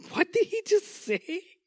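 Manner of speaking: high incredulous scoff; scoffing Sarah. Acted. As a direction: play Sarah scoffing at the promise. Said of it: "picture her kinda scoffing".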